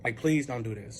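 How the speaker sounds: low, slow retold plea; understated imploring; subdued begging. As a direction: plead quietly, as if worn down. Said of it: "I'm begging".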